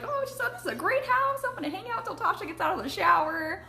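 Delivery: high, airy impression of the clueless friend; mock friend voice. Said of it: "Thinking, she's like".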